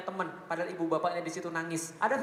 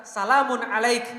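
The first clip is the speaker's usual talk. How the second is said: reverent proclamation; 3 semitones higher, 8 dB louder, slower than usual. Acted. Ibrahim's words, recited.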